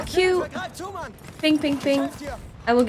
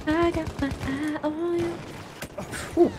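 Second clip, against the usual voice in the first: a lightly voiced higher, menacing tease; put-on voice; 7 semitones higher, 4 dB quieter than usual. Teasing a hidden enemy in a lightly put-on higher voice. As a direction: sing it out playfully to the enemy.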